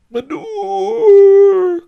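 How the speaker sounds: long, high street vendor's cry; quavering, melancholy shout. A narrator's reading. Old peasant calls his wares, quavering melancholy shout. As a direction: wail it out over the street, drawn out. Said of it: "cried the peasant".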